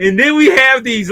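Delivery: breaking into high laughter mid-sentence; laughing. Real, not acted. Telling a friend about the fight scene, laughing. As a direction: laugh through the words.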